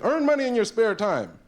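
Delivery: hammed-up spare-time ad; mock ad read. Matchbook ad.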